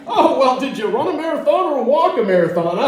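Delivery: imagined party guest mocking him for walking, snide; mocking partygoer. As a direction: needle him with a smug put-down. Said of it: "these party conversations".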